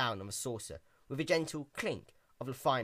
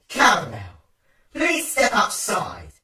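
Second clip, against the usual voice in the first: loud, slow, higher menacing order; menacing command; 7 semitones higher, 11 dB louder, slower than usual. Acted. Twilight ordering Caramel outside, seething menace.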